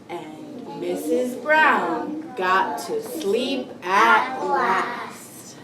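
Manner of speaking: slow, deliberate storybook reading; read-aloud to children. Acted. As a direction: read slowly to a class of young children. Teacher reads picture book aloud.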